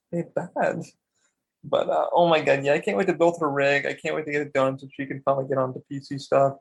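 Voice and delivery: normal talking voice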